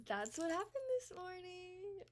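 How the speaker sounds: on the edge of real tears, slowed down; voice breaking. Real, not acted. Vlogger telling viewers about the morning, close to tears. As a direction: let the words crack as the crying starts. Ending story, then about to cry.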